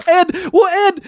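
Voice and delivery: high laughing start. Real, not acted. Co-host joining the laughter, high laugh. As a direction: catch the giggle and go on.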